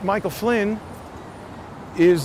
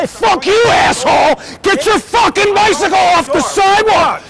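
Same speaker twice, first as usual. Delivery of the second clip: screaming much higher and louder in real rage; furious shout; 12 semitones higher, 11 dB louder than usual. Real, not acted. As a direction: yell him off the sidewalk at full force. Real street fight with cyclist.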